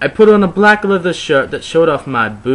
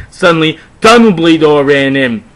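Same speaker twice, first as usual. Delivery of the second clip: ordinary delivery, nothing marked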